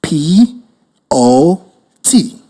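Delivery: letters "P", "O T" spaced apart; spelling it out. Shown as a demonstration. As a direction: dictate "P O T" letter by letter. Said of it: "write the word spelled".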